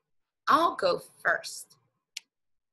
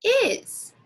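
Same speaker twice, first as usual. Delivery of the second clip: much higher and louder burst; excited reveal; 13 semitones higher, 6 dB louder than usual. Real, not acted. Teacher showing kids the word is, excitedly. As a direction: sing out the answer with delight.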